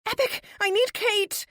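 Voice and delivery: very high, loud desperate yell. Acted. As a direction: cry out for help at full voice. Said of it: "I yell out loud".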